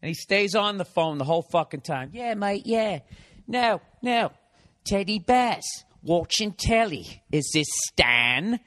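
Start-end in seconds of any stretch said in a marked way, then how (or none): 2.1-8.6 mock English accent. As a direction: ham up a chatty English guy talking on his cell. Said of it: "So he sounds like he's in, you know, Lock, Stock".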